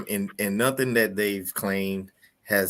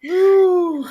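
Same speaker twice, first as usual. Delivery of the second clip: loud, high "woo" after laughing; high-pitched, drawn-out woo of amusement; high whoop; 9 dB louder than usual. Real, not acted. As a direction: let out a big whoop as the laughter ends. Recovering after long laughter.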